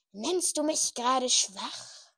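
slow, loud menacing voice. A narrator's reading. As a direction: threaten the servant coldly. Dark lord threatens servant.

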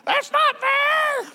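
put-on high, slow, drawn-out kid's whine; whiny child's wail. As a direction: wail it like a child cheated by a sibling. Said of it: "over at the Cox house".

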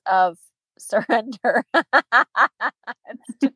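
laughing. Real, not acted. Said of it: "I can't say that word without laughing".

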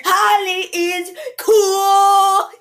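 high, slow, loud cheer; shouted slogan. Pretend. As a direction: holler it out, every word stretched.

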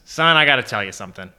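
fast, rattled-off fatherly warning; hurried dad. Retold or imitated. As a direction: rush through the bad news about the uncle. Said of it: "and he goes".

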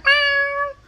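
high, loud imitated meow; mock cat yowl, pitched very high and loud. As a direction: squeal out a startled cat's meow. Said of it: "And then my cat".

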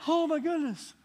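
high, loud oh my goodness; amazed exclamation. Retold or imitated. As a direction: burst out in wonder. Congregation amazed at the story.

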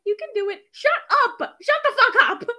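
high, loud mocking voice; taunting classmates, high and loud; mock cheering onlookers. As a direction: mimic the pushy crowd shrilly. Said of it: "they're like".

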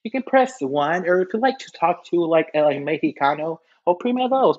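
high, chirpy recorded menu voice; phone menu voice. Pretend. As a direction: sound like an automated hotline. Parody phone menu continues.

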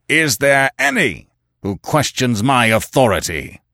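a chief daring his warriors to challenge him; booming challenge to the whole circle; roared. A narrator's reading. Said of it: "he roared".

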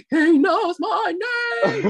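high, loud, slow starstruck exclamation; thrilled disbelief. Retold or imitated. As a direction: shout it out, dazzled. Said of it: "And I was like, gasps".

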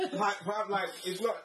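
high, loud laughing protest; laughing disbelief. Real, not acted. Laughing disbelief at "not long.

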